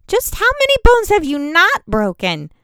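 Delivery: high, loud incredulous demand. Acted. Raina demands of Bannon how many bones are unbroken, incredulous and loud. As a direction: press him, hardly believing it. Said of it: "I demanded incredulously".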